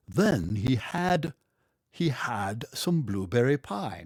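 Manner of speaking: put-on slow, old-fashioned British storyteller; a lightly done British reading voice; British narrator voice. Shown as a demonstration. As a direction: read it like an old British gent. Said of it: "doing it with this British voice".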